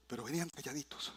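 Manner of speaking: soft, quick hushed aside. Acted. Preacher telling church the disciples followed quietly. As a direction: hush it like a secret.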